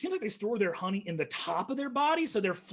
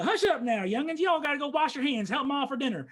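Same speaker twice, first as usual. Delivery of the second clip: put-on higher, louder country farmer shooing kids; drawling rural father hurrying the kids along; folksy farmer dad; 8 semitones higher, 6 dB louder, faster than usual. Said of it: "he says".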